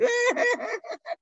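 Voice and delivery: sudden high laugh. Real, not acted. Preacher laughs at the Australian joke, high laugh. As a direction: burst out laughing.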